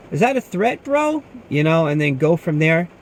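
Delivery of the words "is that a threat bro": high, rising confrontational question; mock challenge. Guard to threatening customer, mock challenge. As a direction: imitate squaring up to him. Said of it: "I was so tempted to say".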